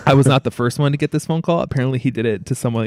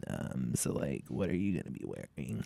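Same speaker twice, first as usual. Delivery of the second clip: low, breathy whisper, much softer; creepy whisper; 10 semitones lower, 18 dB quieter than usual. Retold or imitated. Imitating the weird caller asking what she'll wear, whispered. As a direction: hush it like a creepy prank caller. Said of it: "he'd be like".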